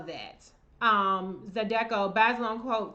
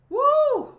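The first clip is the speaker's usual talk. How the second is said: high woo of disbelief; 9 dB louder than usual. Real, not acted. Shocked by coughing stranger.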